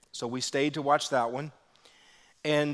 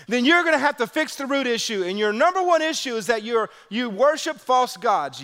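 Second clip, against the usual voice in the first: high, loud preacher's drive; full-force preaching; 8 semitones higher, 6 dB louder than usual. Acted. Pastor preaching to the congregation, full force.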